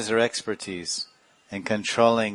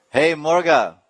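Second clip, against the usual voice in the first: scolding shout; 7 semitones higher, 7 dB louder than usual. Retold or imitated. Scolding the rooster.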